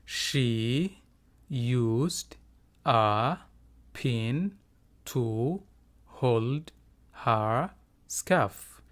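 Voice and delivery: very slow, a clear gap after every word; teacher's slow, separated model reading; word by word for repeating. Shown as a demonstration. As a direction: dictate it one isolated word at a time. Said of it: "Iga daba dheh".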